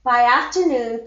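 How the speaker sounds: plain reading voice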